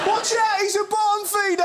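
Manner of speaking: high, loud mock warning; shouted warning. Comic warning about a cartoon fish, high-pitched shout. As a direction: yell it as a comic alarm.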